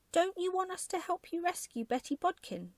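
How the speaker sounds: put-on high, bright, chirpy child voice; chirpy little boy. A narrator's reading. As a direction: sound like a cheerful little lad. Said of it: "feeling quite chirpy now".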